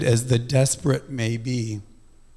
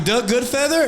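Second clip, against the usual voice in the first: high, startled question; mock shocked squeak; 10 semitones higher than usual. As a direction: caught off guard he picked up. Shocked he answered himself.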